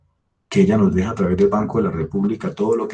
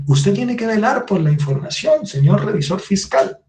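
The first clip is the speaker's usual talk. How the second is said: mimicking the complaining lady in a much higher voice; mock lady's message; 11 semitones higher than usual. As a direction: read her reproach high and pointed, mocking. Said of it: "Decía literalmente". (imitando a la señora con voz mucho más aguda y burlona)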